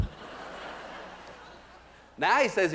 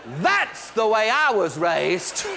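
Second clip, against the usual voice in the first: proud proclamation; 4 semitones higher, much slower than usual. Acted. Comic to audience, slowly booming the family motto. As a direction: ring it out slow and proud like a motto.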